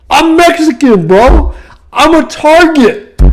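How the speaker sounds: high, loud incredulous shouting; shouting that he was the target; shouting rant. Real, not acted. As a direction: yell it in disbelief at the idea.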